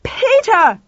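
high, loud bellow. Acted. Lauren bellowing for Tuffy, loud high yell. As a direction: yell his name, all dignity gone. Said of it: "Lauren bellowed".

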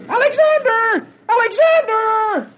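very high, drawn-out calling; top-of-the-voice scream. Acted. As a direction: holler the name down the street, longer each time. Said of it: "screaming at the top of his voice".

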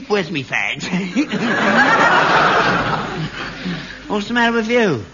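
ordinary talk, nothing marked

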